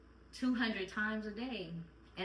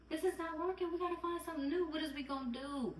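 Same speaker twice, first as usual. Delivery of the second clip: much higher, fed-up cry of frustration; exasperated inner voice; 7 semitones higher than usual. Retold or imitated. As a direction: throw up your hands, pitch it way up. Venting to viewers about parenting.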